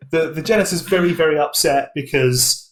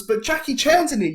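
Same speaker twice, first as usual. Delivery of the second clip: high pleading worker; 10 semitones higher than usual. Retold or imitated. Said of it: "And one of them's".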